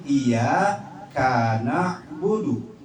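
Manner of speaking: iya kana budu slowly, pause after iya; wrong word break. Shown as a demonstration. Teacher shows how it's written, wrong word break. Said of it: "tulisannya kayak gini".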